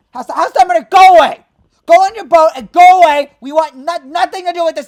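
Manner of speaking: loud, high angry shout; furious yelling. Real, not acted. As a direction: scream it at the owner. Fan furious at team owner.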